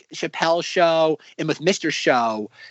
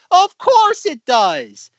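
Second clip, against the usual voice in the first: sketch shopkeeper; 11 semitones higher, 6 dB louder, much slower than usual. Retold or imitated. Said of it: "And it's like".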